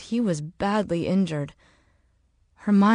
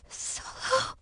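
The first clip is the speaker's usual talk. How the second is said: soft, breathy groan of a name; pained groan; 9 dB quieter than usual. Acted. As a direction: groan it faintly, badly hurt. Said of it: "he groaned".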